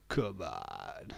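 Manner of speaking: deep, drawn-out groan; mock low groan. Streamer groaning at a Lancashire hot pot. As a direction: drop the voice right down.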